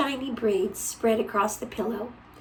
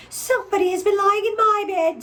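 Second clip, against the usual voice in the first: much higher, louder mama bear; mother bear, pitched up and indignant; mama bear finding her bed used; middle-sized bear; 10 semitones higher, 6 dB louder than usual. A narrator's reading. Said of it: "in her middle voice".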